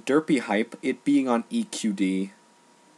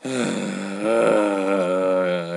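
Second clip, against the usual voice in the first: long, heavy sigh of exasperation; exasperated sigh; 4 dB louder than usual. Real, not acted. Fed up with the role-play site, a heavy sigh. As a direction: let out a big fed-up sigh. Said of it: "Exasperated sigh".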